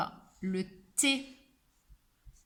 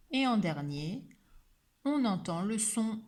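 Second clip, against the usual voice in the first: usual speaking voice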